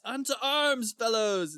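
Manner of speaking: high, ringing mock-heroic call; crying out. King rallies his men to fight, crying out. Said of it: "And he cries".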